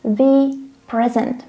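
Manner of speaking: slow, stressed "thee" before PRESENT; emphatic THE PRESENT. Shown as a demonstration. Teacher isolating the stressed phrase, slow emphatic THE present. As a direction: spell out THE present slowly, stressing both words. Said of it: "We wanna stress the word here".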